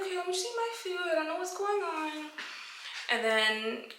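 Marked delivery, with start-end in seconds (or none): 0.0-2.3 high, small, uneasy voice; acting out avoidance in a high, softened voice; hammed-up timid self-talk, pitched way up; awkward retold aside. Retold awkward muttering while eating.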